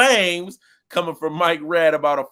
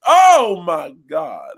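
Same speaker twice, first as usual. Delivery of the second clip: excited shout; 9 dB louder, much slower than usual. Real, not acted. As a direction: explode with excitement at the shot. Excited about Luka's shot.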